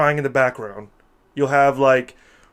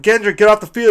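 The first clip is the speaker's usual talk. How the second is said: higher, louder mock yell; 8 semitones higher, 8 dB louder than usual. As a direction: shout him off the field, hammed up.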